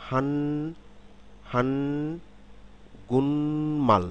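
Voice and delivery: "হান গুনমাল" drawn out, nasal in place of k before "মাল"; slow model of "হান গুনমাল"; word pronunciation, k nasalised. Shown as a demonstration. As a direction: stretch "হান", then glide through "গুনমাল" with no k. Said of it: "শব্দটি যখন আমরা উচ্চারণ করব".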